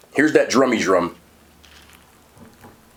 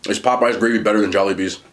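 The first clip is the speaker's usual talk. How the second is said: ordinary talk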